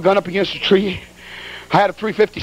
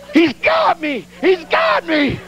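panicked cry; 8 semitones higher, much slower than usual. Retold or imitated. Thinks bear is attacking him.

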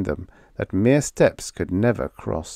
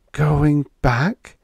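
high, disbelieving question; incredulous; 7 semitones higher, 4 dB louder than usual. A narrator's reading. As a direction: ask as if you can't believe it. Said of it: "she said incredulously".

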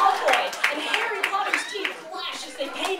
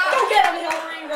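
louder mock cheer from the sidelines; shouting; 3 semitones higher, 5 dB louder, faster than usual. Rahm egging Hillary on, shouting. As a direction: shout it as encouragement. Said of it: "Rahm shouted".

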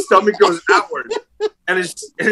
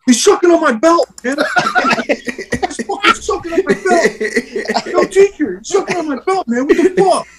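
laughing outrage; 11 semitones higher than usual. Real, not acted.

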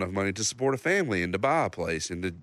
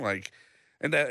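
normal talking voice

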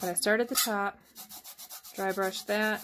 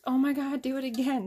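higher, louder burst of amazement; retold excitement; 6 semitones higher, 3 dB louder than usual. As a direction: imitate your own thrilled reaction. Retold reaction to son's game trick.

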